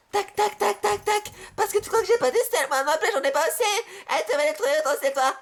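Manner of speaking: high cartoonish voice, laughing; high squeaky voice. High put-on voice, playful.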